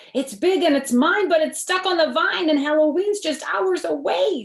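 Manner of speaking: the witch insisting it's hers; a hammed-up storybook witch. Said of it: "said the witch".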